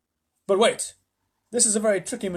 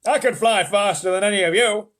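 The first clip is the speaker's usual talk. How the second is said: cried; 5 semitones higher, 8 dB louder than usual. Acted. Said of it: "cried Grandpa George".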